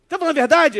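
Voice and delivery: loud, high, pressing question; insistent demand. Retold or imitated. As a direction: demand the truth loudly. Insisting friend swear truth. (pergunta insistente e alta)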